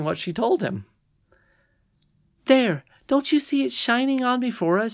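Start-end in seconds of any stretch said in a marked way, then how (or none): 2.4-4.9 very high, eager voice; high-pitched, urgent appeal; excited princess. Acted. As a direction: point it out triumphantly. Irene excitedly shows the thread.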